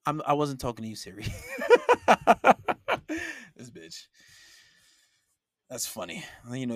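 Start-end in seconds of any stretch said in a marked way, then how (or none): 1.3-3.3 loud high laugh; burst of laughter. Real, not acted. After Siri answers him by mistake, laughing out loud. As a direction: crack up at the interruption.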